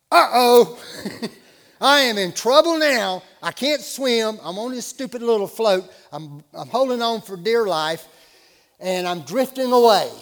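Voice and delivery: comic dawning dread, pushed out loud; mock alarm. Waking adrift on a float unable to swim, mock alarm. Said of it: "my first thought was".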